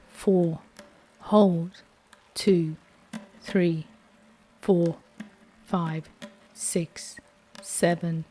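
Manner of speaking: ordinary delivery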